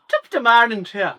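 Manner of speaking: put-on high, loud stage-Irish leprechaun accent; hammed-up Irish leprechaun greeting. Co-host mocks a film actress's Irish accent, hammed-up leprechaun. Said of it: "the leprechaun".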